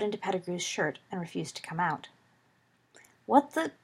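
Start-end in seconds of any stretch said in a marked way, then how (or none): 3.3-3.7 higher-pitched, startled outburst; startled cry. A narrator's reading. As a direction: cry out, caught off guard. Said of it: "Pettigrew cried, startled".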